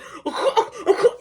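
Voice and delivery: mocking giggle; high giggle. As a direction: giggle like a guilty kid. Brother laughing from shower.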